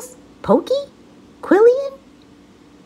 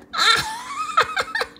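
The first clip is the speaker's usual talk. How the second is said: very high howling laugh; Fluffy howling at the rhino's name. Acted. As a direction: howl with laughter. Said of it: "howled with laughter".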